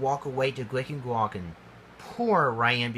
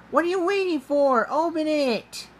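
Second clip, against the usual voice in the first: high, loud, quick urging; eager urging; 4 dB louder than usual. A narrator's reading. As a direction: hurry them on excitedly, pitched way up. Cleo urges Ryan to open box.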